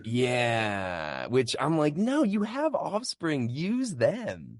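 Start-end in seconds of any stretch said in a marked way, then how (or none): 2.0-4.6 mock protest. Own reaction to song choice.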